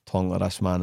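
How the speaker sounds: low, soft, broad Glaswegian; inside Glaswegian accent. Shown as a demonstration. Coach demonstrating the voice in his head, low broad Glaswegian. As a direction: mumble it low in thick Glaswegian. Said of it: "My inside accent is".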